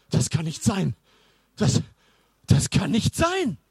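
breathless, rising disbelief; puffing. A narrator's reading. Sven stops under the linden after running, puffing in disbelief. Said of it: "schnaufte Sven immer wieder".

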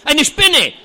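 very high, loud shriek; a high, loud cry of horror at the spider; shriek of disgust. A narrator's reading. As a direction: recoil at the spider with a scream. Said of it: "stieß Formulus voll Abscheu hervor".

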